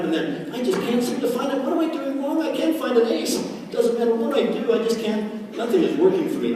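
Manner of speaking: high-pitched helpless griping; mock complaining. Struggling recruit moaning to Phil, mock complaining.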